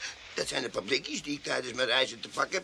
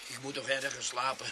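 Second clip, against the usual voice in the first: ordinary talk, nothing marked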